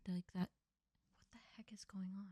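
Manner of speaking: spooked whisper. Real, not acted. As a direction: breathe it out, barely voiced. Spooked, alone watching the video.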